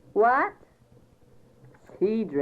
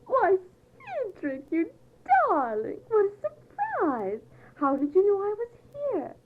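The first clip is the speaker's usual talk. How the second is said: gushing; 8 semitones higher than usual. Acted. Gushing at fiancé on phone.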